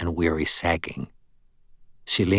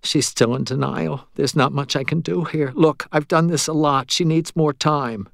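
higher-pitched voice explaining patiently; resigned medium; 6 semitones higher than usual. Acted. Medium tells the ghost she needs time, higher resigned voice. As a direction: pitch it up as the medium making excuses.

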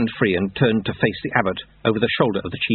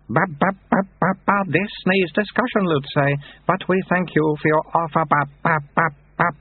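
put-on high-pitched abbot, dignity broken by baby babble; babbling baby abbot; 9 semitones higher than usual. Abbot declining Lutze's offer, babbling like a baby. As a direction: mix solemn orders with infant noises.

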